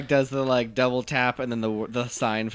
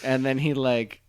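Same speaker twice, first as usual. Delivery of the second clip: ordinary talk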